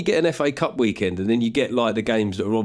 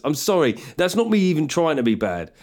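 normal talking voice

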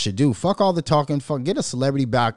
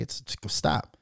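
ordinary talk

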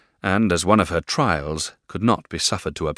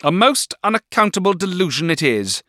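much higher, louder shrill outcry; Miss Murdstone cry; 14 semitones higher, 7 dB louder than usual. A narrator's reading. Miss Murdstone cries out in scorn, higher and louder. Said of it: "cried Miss Murdstone".

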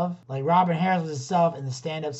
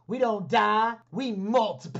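mock movie catchphrase; 6 semitones higher than usual. Quoting the Bebe's Kids catchphrase.

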